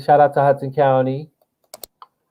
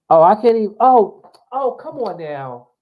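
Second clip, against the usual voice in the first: oh no, realising the chance is gone; frustrated high oh oh; dismayed surprise; 8 semitones higher than usual. Real, not acted. Dismay at missed rally.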